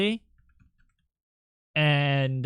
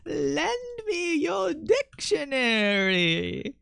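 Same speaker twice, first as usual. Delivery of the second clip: "Lend me your dictionary" slow, high, rising and drawn out; drawn-out high singsong; 9 semitones higher, much slower than usual. Shown as a demonstration. To stream chat, a slow high singsong code phrase. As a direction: stretch each word high and slow. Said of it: "is a good one".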